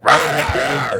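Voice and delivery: playful growly "rah rah"; hammed-up roar, not truly angry; mock big-guy roar. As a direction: imitate a big scary guy roaring. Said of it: "people gotta read that with my big head".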